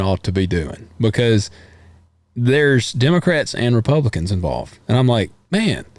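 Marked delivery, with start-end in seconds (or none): 5.5-5.8 much higher, rising "there you go"; pleased, approving exclamation; a friendly, lifted "man, there you go"; approving cheer. Retold or imitated. Approving bipartisan bill.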